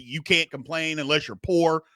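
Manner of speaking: ordinary delivery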